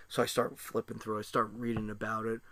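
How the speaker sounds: usual speaking voice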